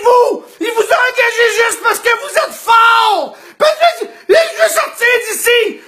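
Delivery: patient shouting accusations at the orderly; angry shout. Retold or imitated. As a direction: blame him at full voice.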